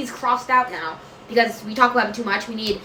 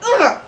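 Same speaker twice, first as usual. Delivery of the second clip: loud, high grunt of disgust; disgusted ugh; 11 semitones higher, 5 dB louder than usual. Real, not acted. Vlogger recoiling from their own doll voice, loud ugh. Recoils after doing Barbie voice.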